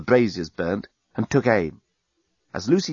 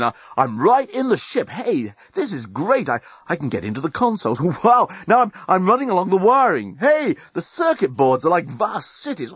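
thrilled; 7 semitones higher than usual. A narrator's reading. Dan thrilled in virtual reality.

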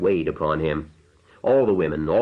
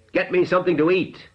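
shout; 13 semitones higher, faster than usual. A narrator's reading. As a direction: bark it out, fist on the table. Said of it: "he shouted".

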